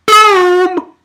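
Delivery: excited shout; hyped boom. Real, not acted. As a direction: slam it out like a hit landing. Hyped exclamation.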